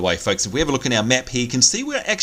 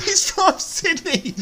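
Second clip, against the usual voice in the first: laughing disbelief; 12 semitones higher, slower than usual. Real, not acted. Reacting to guest's hometown.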